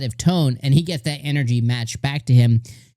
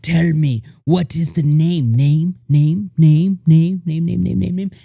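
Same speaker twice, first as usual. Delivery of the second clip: loud mock pleading, easing off on the repeats; mock frantic begging; 5 dB louder than usual. Everyone online begging for the anime's name, mock frantic. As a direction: imitate a crowd badgering for an answer. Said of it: "everyone goes like".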